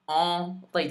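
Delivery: usual speaking voice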